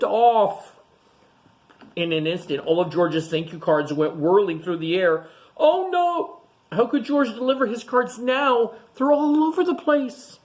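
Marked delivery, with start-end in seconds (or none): 5.6-10.4 high, alarmed storybook gasp; dismayed oh no. Acted. Teacher to class, high alarmed dismay. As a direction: gasp at the mess for the children. Teacher reacting to scattered cards.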